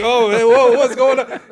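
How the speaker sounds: loud, high startled whoa; startled shock. Retold or imitated. Client opens door half naked.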